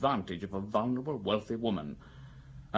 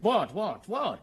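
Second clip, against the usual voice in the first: impatient demand; 9 semitones higher, 4 dB louder, slower than usual. Acted. Magistrate impatiently cuts Maigret off.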